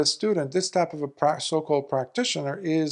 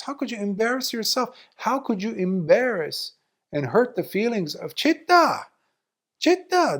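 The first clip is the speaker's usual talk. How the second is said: higher-pitched scolding, rising to sharp peaks; 6 semitones higher than usual. Retold or imitated. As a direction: scold a foolish monk in disbelief. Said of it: "Lord Buddha scolds him".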